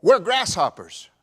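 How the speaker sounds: loud, slow, high preaching cry; fiery preacher shout; full-force preaching. Acted. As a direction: thunder it out to the congregation.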